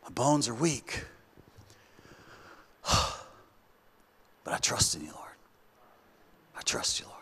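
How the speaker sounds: sighing, slow, whispered confession; weary whisper. Shown as a demonstration. Preacher shows David's true tone, whispering to God. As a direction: let it fall to a broken breath. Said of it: "I feel like he's saying".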